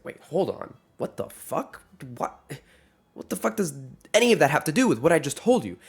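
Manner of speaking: a half-acted indignant cursing burst, rising up; mock angry outburst. Son snapping at dad over weight jab, half-acted anger. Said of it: "Why are you cursing and raising your voice at me?".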